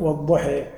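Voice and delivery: والضحى with the final a tilted to an e; imala. Shown as a demonstration. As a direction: end والضحى on an "eh" vowel. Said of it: "بعض العرب يميلون ال". («والضحى» بإمالة الألف الأخيرة)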